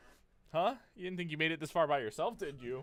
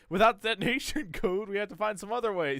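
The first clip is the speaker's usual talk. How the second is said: breaking into laughter mid-line, louder and higher; can't keep a straight face reading it; laughing through the line; 6 semitones higher, 6 dB louder than usual. Acted. Streamer reading Miller's codec line, cracking up.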